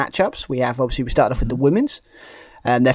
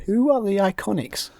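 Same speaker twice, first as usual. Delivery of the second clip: mock baffled politicians; 8 semitones higher than usual. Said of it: "politicians, all just clapping around saying".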